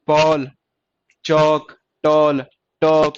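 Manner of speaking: पॉल, चौक, टॉल, टॉक with long open vowels; slow, separate model words; stretched vowel. Shown as a demonstration. Teacher showing the shared sound, stretched vowel. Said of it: "ओ की sound आ रही है".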